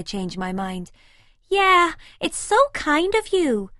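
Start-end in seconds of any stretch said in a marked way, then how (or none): 1.5-3.7 put-on much higher, slow, sweet girlish voice; a breezy American girlfriend cooing; happy American girl. A narrator's reading. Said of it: "she happily murmurs".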